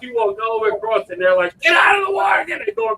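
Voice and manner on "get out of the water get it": frantic lifeguard yell. Retold or imitated. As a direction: shout her out of the water, going crazy. Lifeguards yelling again.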